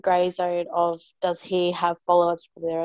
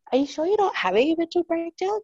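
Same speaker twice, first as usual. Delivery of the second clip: much higher, teasing mum's voice; worried mum; 10 semitones higher than usual. Retold or imitated. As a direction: play her mum, half-amused and concerned. Mum quoted.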